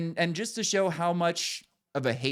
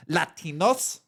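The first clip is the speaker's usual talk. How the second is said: mock Spanish-accented "Latinos" with a rolled, higher lift; exaggerated Spanish pronunciation of "Latinos"; 4 semitones higher, slower than usual. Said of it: "until she goes".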